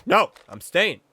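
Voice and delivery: lightly voiced stubborn dad; hint of a firm, unbudging movie dad. Movie dad refuses to leave the date, lightly played stubbornness. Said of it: "she's the stereotypical movie dad".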